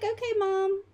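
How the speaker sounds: put-on high obedient kid reply; son voice. Son agreeing to set the table, high-voiced. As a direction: answer like a dutiful boy. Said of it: "He's like".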